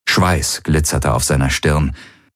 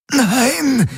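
croaked no, very high and strained; croaking. A narrator's reading. Strangled by his own hand, he croaks no. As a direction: squeeze it out through a choked throat. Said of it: "krächzte er".

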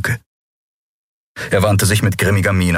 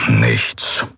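lower, let-down grumble; disappointed grumble; 8 semitones lower than usual. A narrator's reading. Keru finishing the fruitless search, a disappointed low grumble. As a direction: grunt it out, clearly disappointed. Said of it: "brummte Keru hörbar enttäuscht".

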